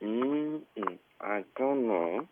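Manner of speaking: ordinary talk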